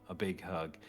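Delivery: normal talking voice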